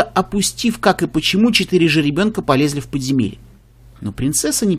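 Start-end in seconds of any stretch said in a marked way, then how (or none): none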